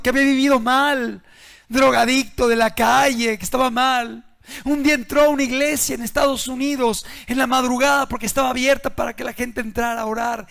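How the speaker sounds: full-force sermon voice; fiery preaching. Acted. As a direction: drive the warning home from the pulpit.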